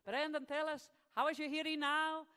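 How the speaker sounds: loud, high mock Italian accent; mock Italian-accented Pope. Pope asking Brenton after the prayer, mock Italian accent. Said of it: "the Pope takes his hands away".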